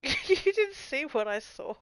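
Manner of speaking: high, slow, loud through laughter; laughing protest. Real, not acted. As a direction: insist through giggles. Laughing, defending her reaction.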